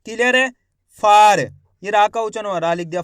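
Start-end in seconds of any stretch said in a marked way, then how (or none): 1.0-1.4 फार called out loud with a long आ; loud syllable demo. Shown as a demonstration. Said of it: "फा का उच्चारण यहाँ पे खींच को रहा". ("फार" को ज़ोर से खींचकर बोलें)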